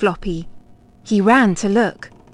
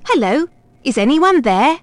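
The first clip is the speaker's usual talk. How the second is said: voice on the phone; 4 semitones higher, 3 dB louder than usual. A narrator's reading. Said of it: "A voice said".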